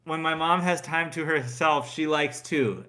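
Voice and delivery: put-on high, loud child reading aloud; schoolkid reciting. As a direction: recite it like a kid filling in a school sheet. Child filling in Mother's Day sheet.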